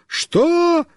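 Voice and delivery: high, calling shout; high-pitched yelled reply. A narrator's reading. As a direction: holler back while running after someone. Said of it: "Мишка побежал за мной и кричит".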